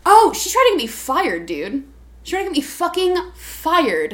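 loud, high-pitched outrage; worked-up indignation; indignant. Real, not acted. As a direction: vent it, punching the key words. Indignant about nearly being fired.

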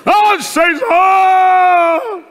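high, loud, panicked wail to God; desperate cry. Retold or imitated. Preacher mocking believers' panicked cry. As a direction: cry out in mock despair, stretching Senhor. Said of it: "grito de desespero".

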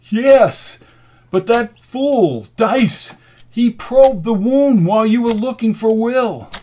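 high, loud, drawn-out outrage; exasperated anger. A narrator's reading. Rasputin tells McKenna of Dice's blunder, exasperated.

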